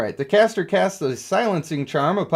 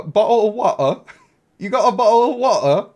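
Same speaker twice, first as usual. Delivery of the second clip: high, loud mock-British with the consonants dropped; British accent, dropped consonants; 7 semitones higher, 6 dB louder than usual. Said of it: "sometimes they don't use any consonants".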